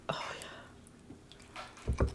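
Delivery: breathy unvoiced whisper. Real, not acted. Savoring food, whispered.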